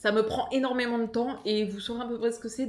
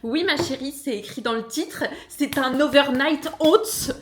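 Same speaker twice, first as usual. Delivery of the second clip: louder, higher sarcastic comeback, last words drawn out; mock exasperation; 4 semitones higher, 6 dB louder, slower than usual. Vlogger answering nitpicking recipe commenters, mock exasperated. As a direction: spell it out loudly to a silly commenter.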